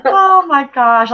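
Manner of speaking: drawn-out, laughing; slow, loud laughing exclamation; slow, loud amused oh my gosh. Real, not acted. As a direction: stretch it out amused.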